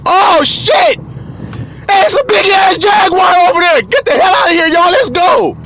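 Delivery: high, urgent alarm shout; screamed warning. Acted. Hiker spotting a jaguar, screaming warning to friends. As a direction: scream the warning to the whole group. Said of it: "why the fuck are you screaming?".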